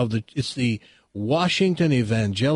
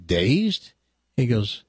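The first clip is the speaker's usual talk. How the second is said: ordinary talk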